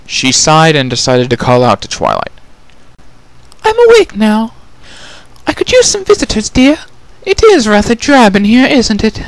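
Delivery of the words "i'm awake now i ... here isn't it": high, refined and genteel lady; Rarity's voice. Acted. Changeling prisoner calls out to Twilight, refined and high. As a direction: coo it sweetly, much higher.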